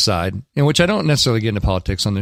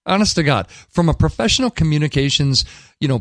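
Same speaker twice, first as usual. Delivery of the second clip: usual speaking voice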